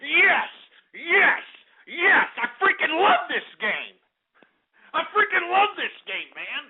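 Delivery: high, exultant cheering; gleeful celebration. Real, not acted. Host celebrating his favorite game, gleeful celebration. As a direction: crow each word in high-pitched delight.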